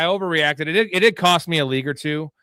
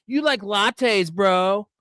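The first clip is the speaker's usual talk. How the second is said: mocking bro voice; 7 semitones higher, much slower than usual.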